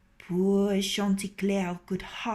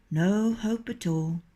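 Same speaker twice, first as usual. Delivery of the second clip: usual speaking voice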